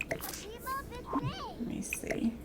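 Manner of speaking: whispered to herself, slow. Real, not acted. Streamer mutters to herself placing a bowl, whispered. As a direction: whisper it while concentrating. Quiet aside while placing object.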